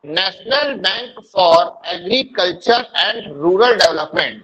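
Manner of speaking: very slow, words stretched for writing; slow dictation. Shown as a demonstration. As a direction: dictate slowly to the students. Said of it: "लिखिएगा". (धीमे लिखवाना)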